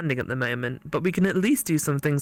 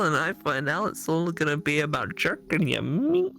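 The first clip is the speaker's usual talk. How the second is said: normal talking voice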